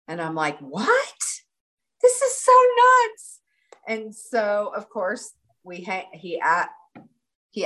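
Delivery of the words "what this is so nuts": high, loud retold disbelief; astonished outburst. Retelling her shock that he lives there, astonished. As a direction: shoot up from a startled question to a loud squeal. Retells amazement at shared hometown.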